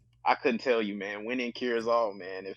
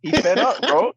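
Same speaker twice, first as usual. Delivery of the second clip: high, loud laughing shout; laughing loudly; 11 semitones higher, 7 dB louder than usual. Real, not acted. Friend cracking up at the owner's rant, laughing loud. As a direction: laugh it out, pitch climbing.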